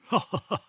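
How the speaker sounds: short, high sly fox chuckle; a quick knowing chuckle from the fox. A narrator's reading. Fox chuckling at the boastful gingerbread man, sly chuckle. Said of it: "The fox chuckled".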